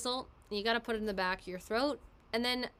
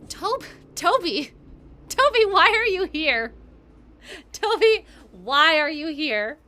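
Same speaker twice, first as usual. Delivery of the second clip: excited call; 7 semitones higher, 14 dB louder, slower than usual. Real, not acted. Greeting a friend in chat.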